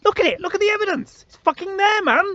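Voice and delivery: very high, strained outburst; exasperated. Retold or imitated. Arguing with doubting friends, high exasperated outburst. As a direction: plead in disbelief, cracking upward. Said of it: "I'm like".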